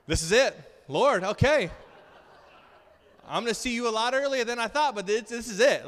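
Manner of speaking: loud, high comic last prayer; mock doomed panic. Passenger praying in turbulence after wife's dream, hammed-up panic. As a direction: cry out to God as if doomed.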